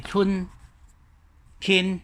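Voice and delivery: 春 and 天 each said apart in Mandarin citation tones; syllables said one by one. Shown as a demonstration. Teacher showing Mandarin syllables one by one. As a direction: pronounce 春 then 天 as separate syllables. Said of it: "在国语里面".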